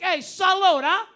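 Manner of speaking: loud, high hammed-up Italian cheer; toasting the whole bar in mock Italian. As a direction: shout salute like an over-eager Italian host. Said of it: "They're like".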